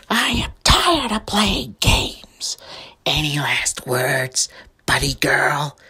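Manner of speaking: slow, whispered final threat; menacing whispered villain voice. Acted. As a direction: whisper it like a snake closing in.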